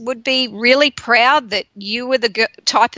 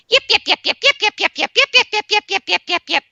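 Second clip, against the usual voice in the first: yappy little dog; 10 semitones higher, faster than usual. Retold or imitated. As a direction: yap it out nonstop like an annoying little dog. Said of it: "those yappy little dogs that don't shut up".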